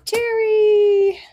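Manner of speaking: high, loud sustained name call; playful, stretched-out high holler. Real, not acted. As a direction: sing out the name on one long held note. Calls out next person's name.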